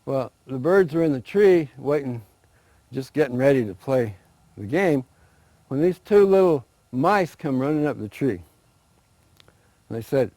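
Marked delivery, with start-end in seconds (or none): none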